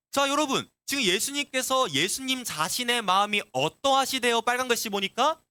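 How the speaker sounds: usual speaking voice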